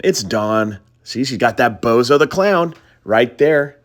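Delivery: usual speaking voice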